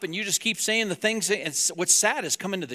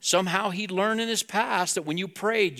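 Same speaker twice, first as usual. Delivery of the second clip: usual speaking voice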